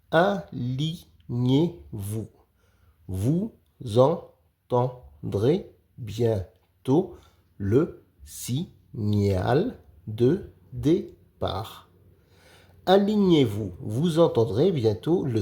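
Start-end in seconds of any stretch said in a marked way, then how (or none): 3.1-11.9 very slow decoding read, each syllable spaced. Shown as a demonstration. Teacher sounds out the sentence slowly for young readers. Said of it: "Lis les phrases".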